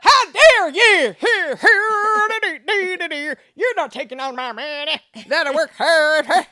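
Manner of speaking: high, loud hammed-up Southern yokel; mock Southern accent. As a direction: shout it like an outraged Southern Republican. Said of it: "to give it a Southern accent".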